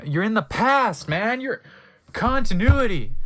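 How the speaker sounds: real disbelieving yell; exasperated rant. Real, not acted. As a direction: yell at the plot hole. Reader rants at story.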